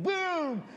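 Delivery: loud, high, drawn-out explosion noise; huge boom. Retold or imitated. Boy promising his buddy a huge explosion, big loud boom. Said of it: "it's gonna be huge, like".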